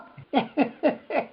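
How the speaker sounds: high gleeful cackle; cackling laugh. Retold or imitated. Laughing at the husband's wound, gleeful cackle. After the gloating quote.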